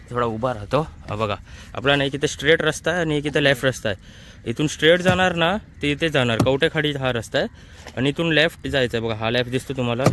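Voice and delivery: usual speaking voice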